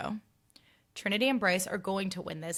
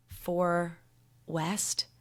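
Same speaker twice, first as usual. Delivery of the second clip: ordinary talk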